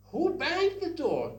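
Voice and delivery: high, loud angry demand; bad-voice angry demo. Shown as a demonstration. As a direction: yell it like someone cross about a slammed door. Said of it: "with bad voice".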